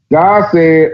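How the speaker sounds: ordinary delivery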